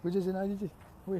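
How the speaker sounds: acted bashful stammer; flustered denial. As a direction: stumble over an embarrassed denial. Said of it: "he just blushes".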